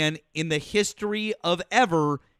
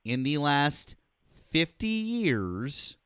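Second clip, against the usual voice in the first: drawn out word by word, very slow; slow pointed question; slower than usual. Real, not acted. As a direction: lay each word down slowly to corner him. Caller pressing host with pointed question.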